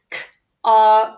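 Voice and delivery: ordinary delivery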